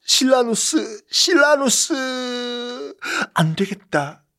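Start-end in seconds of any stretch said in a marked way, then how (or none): 0.0-3.0 높고 느리게 간드러지게 부르기; 애틋하게 늘어지며 이름 부르기; 애달픈 부름. Acted. 황후가 새아버지를 부르며 애달파함. (높고 느리게 애타게 부르는 목소리)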